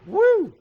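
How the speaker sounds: loud, high-pitched woo; excited whoop. Real, not acted. Streamer switches on bright work light, high loud whoop. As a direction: let out a short piercing whoop.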